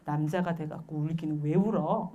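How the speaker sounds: ordinary talk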